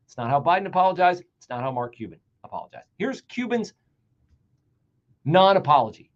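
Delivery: ordinary talk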